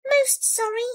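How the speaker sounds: high, polite little voice; apologetic mouse. A narrator's reading. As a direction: squeak a meek apology. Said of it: "said the Mouse".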